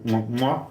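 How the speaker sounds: two big smacking air kisses; exaggerated kissing sounds; blown kisses. Real, not acted. Blows kisses to a greeted viewer.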